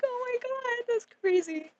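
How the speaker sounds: high, strained shocked cry; shocked oh my god. Retold or imitated. Hearing the stranger ask about her friend, a high shocked cry. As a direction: squeal it in nervous disbelief.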